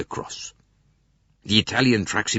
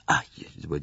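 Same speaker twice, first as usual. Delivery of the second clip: ordinary delivery, nothing marked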